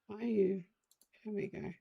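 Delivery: usual speaking voice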